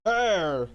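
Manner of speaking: a loud put-on growl of hesitation; loud gruff grunt. Sailor stalling the question, loud grunt. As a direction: grunt like a caught guard.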